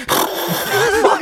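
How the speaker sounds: high laughing fuck; cracking up under the towel; laughing curse. Retold or imitated. Machine shaking his face.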